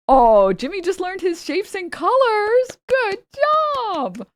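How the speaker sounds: condescending. Pretend. Mocking a chud as a small child, patronizing. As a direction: talk down like to a toddler.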